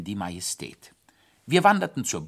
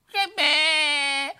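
high crying wail; howling wail; 6 dB louder than usual. Acted. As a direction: wail like a scared hare. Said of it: "heulte der Hase".

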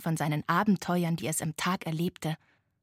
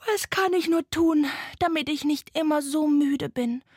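higher, plaintive ghost voice; tired little ghost; 7 semitones higher, 3 dB louder than usual. A narrator's reading. Tired ghost asking the owl for help, high plaintive voice. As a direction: plead for advice as a small ghost. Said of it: "fragte das Gespenst".

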